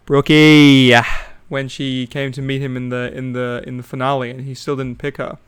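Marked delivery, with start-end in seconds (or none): 0.0-0.9 loud drawn-out call of "Brookie"; imitating Jimmy. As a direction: belt "Brookie" like a lovestruck bachelor. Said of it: "I remember him saying".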